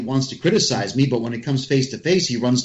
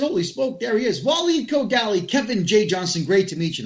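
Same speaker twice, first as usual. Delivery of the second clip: cheery greeting; 8 semitones higher than usual. Retold or imitated.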